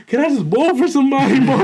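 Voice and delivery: put-on high-pitched, eager drunken plea; drunk brother. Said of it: "This man's drunk as shit".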